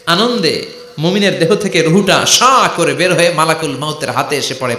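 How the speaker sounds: usual speaking voice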